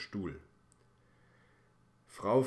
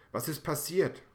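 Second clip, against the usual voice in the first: much higher and louder, hurried call; breathless call; 9 semitones higher, 7 dB louder than usual. Acted. Paramedic bursts in asking what happened, higher and louder. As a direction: rush in and call out. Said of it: "ruft einer der Retter und schnappt nach Luft".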